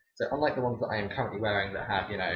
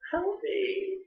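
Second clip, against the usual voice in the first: mock cry for help. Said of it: "mock cry for help".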